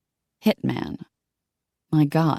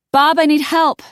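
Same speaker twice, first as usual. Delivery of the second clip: high desperate cry for help; desperate shout; 13 semitones higher, 6 dB louder than usual. A narrator's reading. Desperate plea on phone.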